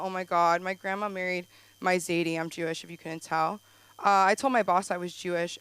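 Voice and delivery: normal talking voice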